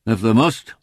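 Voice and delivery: grudging northern farmer's assent; ungracious. A narrator's reading. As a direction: let them in with a touch of ill grace. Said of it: "said the man ungraciously".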